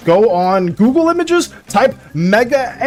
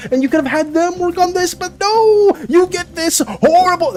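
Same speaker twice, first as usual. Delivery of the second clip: heated rant; 10 semitones higher than usual. Real, not acted. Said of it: "I'm getting heated again".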